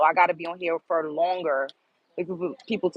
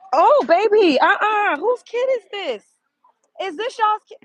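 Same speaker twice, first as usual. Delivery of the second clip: high, loud startled protest; 13 semitones higher, 7 dB louder than usual. Real, not acted. Woman to a stranger's child who just touched her, startled.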